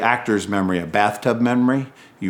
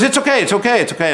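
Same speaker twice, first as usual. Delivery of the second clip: reassuring; 12 semitones higher, 4 dB louder, much faster than usual. Retold or imitated. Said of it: "And he goes".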